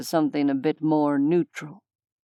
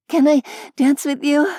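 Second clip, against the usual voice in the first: put-on high, louder eager young woman's voice; breathless goth girl; 11 semitones higher, 6 dB louder than usual. A narrator's reading. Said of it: "asked a breathless human girl".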